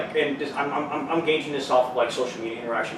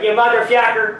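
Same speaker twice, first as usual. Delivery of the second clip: put-on loud Canadian accent yell; 9 semitones higher, 10 dB louder than usual. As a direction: shout it like a Canadian. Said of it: "saying motherfucker in a Canadian accent".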